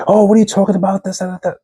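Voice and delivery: high, scoffing mimic of a partner brushing it off; imitating a girlfriend's dismissive tone mid-argument, mocking. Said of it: "and then you're just like".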